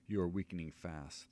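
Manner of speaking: usual speaking voice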